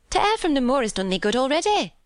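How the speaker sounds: chatty maid with Yorkshire speech. Acted. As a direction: speak up high as a Yorkshire servant girl. Martha, Yorkshire maid in the story.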